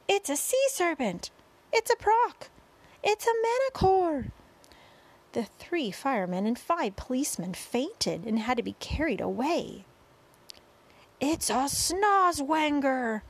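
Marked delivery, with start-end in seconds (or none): 0.1-4.3 very high panicked cries; alarmed shouting. A narrator's reading. Terrified policemen and firemen guess at the monster, alarmed shouting. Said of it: "all started shouting at once". 11.2-13.2 high, long drawn-out cry; alarmed cry. A narrator's reading. Chief of police names the monster above, alarmed cry. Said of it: "cried the chief of police".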